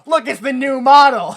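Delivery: high, loud announcement; mock unveiling shout. Pulling the curtain off the new model, shouted. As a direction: shout it like a big reveal.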